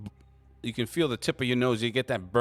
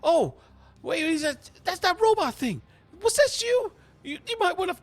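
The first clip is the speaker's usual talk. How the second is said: much higher, loud startled woman's voice; Abby shocked; 5 dB louder than usual. Acted. GM voicing Abby spotting the robot. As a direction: gasp and accuse in a high panicky voice. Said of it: "She said".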